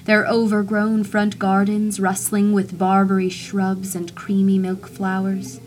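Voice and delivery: ordinary delivery, nothing marked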